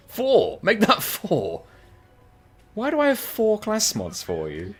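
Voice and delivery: high, drawn-out exclamations; hammed-up incredulous outburst; exaggerated amazement. Real, not acted. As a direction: start loud and high, then stay high in disbelief. Counts surplus items in mock amazement.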